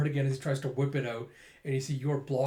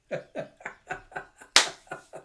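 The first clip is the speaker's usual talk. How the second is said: breathy, voiceless chuckling; laugh. Real, not acted. Reader amused by the hairstyle worry, breathy laugh. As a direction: laugh in short puffs. Laughs after acting the panic.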